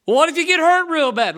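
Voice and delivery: loud, high mock-worried challenge; skeptic's question. Doubters questioning the preacher, loud mock-worried voice. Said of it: "And people say".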